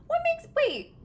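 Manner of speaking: sudden high yelp of surprise; high startled break-off. Real, not acted. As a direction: caught off guard, pitch jumps way up. Reacts to Russian accent comment.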